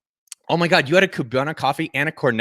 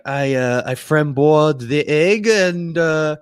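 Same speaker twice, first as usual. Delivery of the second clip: hammed-up, drawn-out French accent; clumsy mock French accent, teasing a producer; shitty French accent; slower than usual. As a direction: imitate a Frenchman with a bad accent. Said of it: "that's my shitty French accent".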